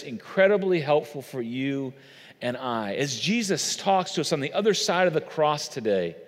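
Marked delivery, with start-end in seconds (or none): none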